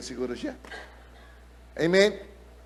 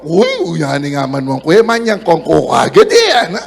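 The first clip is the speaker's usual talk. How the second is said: put-on Kapampangan accent; 3 semitones lower, 6 dB louder than usual. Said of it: "Kapampangan niya eh".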